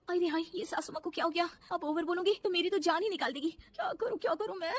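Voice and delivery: high-pitched, worried self-talk; panicky fretting. Acted. Daughter-in-law finds mother-in-law frozen, panicking. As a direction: fret helplessly, pitch climbing.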